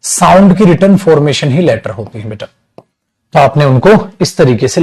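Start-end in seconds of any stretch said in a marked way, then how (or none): none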